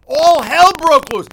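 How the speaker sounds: high, loud and slow for drama; dramatic. Acted. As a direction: ham up the big moment. Dramatic host story setup.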